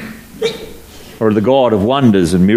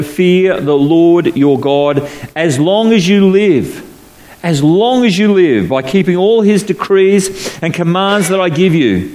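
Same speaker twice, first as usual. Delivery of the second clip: raised, deliberate Bible reading; scripture reading; 4 semitones higher, slower than usual. Acted. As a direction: read the verses out to the congregation. Reads scripture passage aloud.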